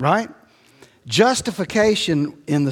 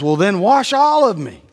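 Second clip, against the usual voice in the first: eager Peter; 6 semitones higher, 6 dB louder than usual. Retold or imitated. Peter pleading to be washed.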